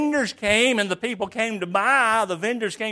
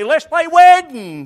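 loud, high eager invitation; a kid rallying friends to play; preacher voicing an eager child calling out a game; 6 semitones higher, 10 dB louder, slower than usual. Children's game quarrel acted out.

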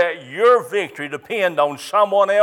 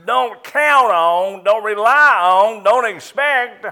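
"don't" punched, higher and louder, slow; stress on don't; 5 semitones higher, 6 dB louder than usual. Shown as a demonstration. Preacher urging his flock, stressing every "don't". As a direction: hammer each "don't" in a rising run. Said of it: "did you hear the stress on that word that time".

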